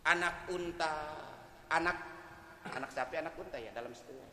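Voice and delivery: ordinary delivery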